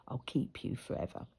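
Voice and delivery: a low, secret whisper of a vow; promising the pigeon she will keep it, whispered; whispered promise. Retold or imitated.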